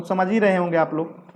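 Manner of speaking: normal talking voice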